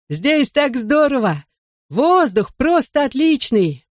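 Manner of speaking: high, slow, stretched-out delight; exhilarated calling. A narrator's reading. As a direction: call down from the sky, stretching the words. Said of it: "отозвался из поднебесья Бен".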